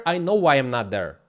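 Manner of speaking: ordinary talk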